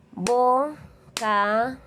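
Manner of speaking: "Bocadillo" split into four slow separate beats, bo-ca-di-llo; syllable by syllable. Shown as a demonstration. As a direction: break "Bocadillo" into drawn-out syllables, a pause after each. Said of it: "vamos a escuchar atentamente sus golpes de voz".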